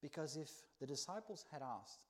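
usual speaking voice

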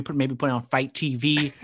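normal talking voice

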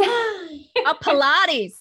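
drawn-out mimicry of an American accent; imitated American accent. Host teasing guest over how she says Pilates, imitated American accent. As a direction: playfully echo the word in an American accent. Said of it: "Sorry, it's that accent".

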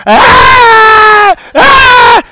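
put-on high, loud, drawn-out toddler wail; piercing mock tantrum scream; imitated child screaming. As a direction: mimic a boy screaming at the top of his lungs. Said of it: "that boy screaming to the top of his lung".

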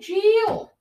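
sharp, high, loud "Chill." snapped off mic; yelling at someone. Real, not acted. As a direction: yell "Chill." to shut someone up. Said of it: "You got me yelling on the podcast".

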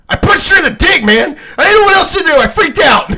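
high, loud, half-crying confession; retelling the friend's freak-out, high and loud; panicked yell. Retold or imitated. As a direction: blurt it out in a panic, nearly crying. Friend's retold panic, shouted.

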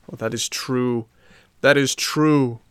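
mildly tearful admission; crying. A narrator's reading. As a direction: own up with a touch of weeping.

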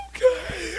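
high, tearful squeal; mock bawling. Crying man agrees to pray, mock bawling. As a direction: imitate a man crying through his answer. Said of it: "starts bawling uncontrollably".

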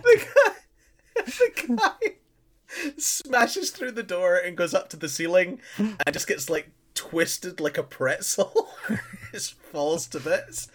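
laughing through the retelling. Real, not acted. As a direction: giggle while you tell it. Laughing while retelling scene.

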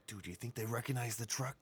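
anxious hushed whisper. Acted. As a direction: whisper it nervously. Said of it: "We don't have to whisper this".

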